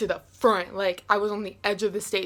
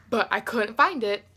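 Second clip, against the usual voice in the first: usual speaking voice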